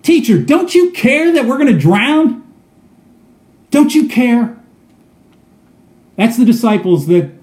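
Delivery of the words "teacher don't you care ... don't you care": high, desperate cry over the storm; frightened shout. Acted. Disciples shouting at sleeping Jesus, high and desperate. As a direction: plead with him as if about to drown. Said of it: "woke him up shouting".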